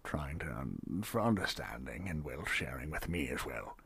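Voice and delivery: a hint of a low, soft bartender voice; quiet, murmured innkeeper talk; lightly voiced bartender.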